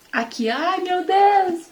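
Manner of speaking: high, drawn-out cry of delight; a slow, delighted gasp of discovery; excited exclamation. Real, not acted. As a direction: sing out the relief of finding it. Finds the verse she wanted.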